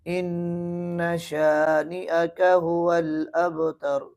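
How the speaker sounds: slow chanted recitation; slow tajweed chanting; Quranic recitation. Shown as a demonstration. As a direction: recite the verse slowly and melodically. Recites final verse.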